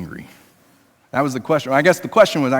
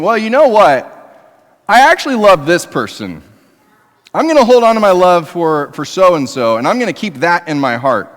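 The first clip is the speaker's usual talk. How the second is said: raised, louder voice of a spouse mock-confessing; cheating spouse; 4 semitones higher, 6 dB louder than usual. Spouse announcing a lingering love for someone else, loud and mock-earnest. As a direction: play the unfaithful spouse, a bit hammed-up. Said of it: "they're sitting there over there going".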